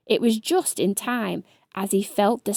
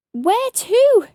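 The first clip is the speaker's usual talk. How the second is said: high, louder frightened cry; cried out; 12 semitones higher, 7 dB louder than usual. A narrator's reading. Scared hedgehog asking ladybird, high cry. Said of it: "cried Spiky".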